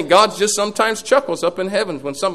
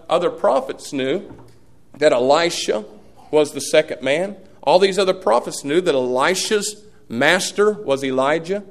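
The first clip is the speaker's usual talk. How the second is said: usual speaking voice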